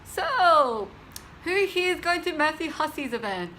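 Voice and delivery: high, loud, upbeat call to the group; bright icebreaker to a lift full of strangers; retelling her own chirpy question to the girls in the elevator; cheery elevator question. Retold or imitated. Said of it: "and then I was like".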